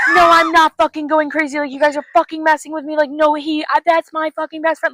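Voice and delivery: high, loud frantic tirade; losing it. Retold or imitated. Heroine at the morgue insisting she isn't crazy, losing it. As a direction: act out a hysterical, shouted protest. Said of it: "she's, like, losing it".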